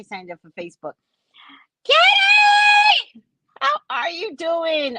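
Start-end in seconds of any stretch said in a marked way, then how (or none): 1.8-5.0 high, loud shout of the name; screaming greeting to a friend; joyful shout. Pretend. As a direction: yell her name in delight.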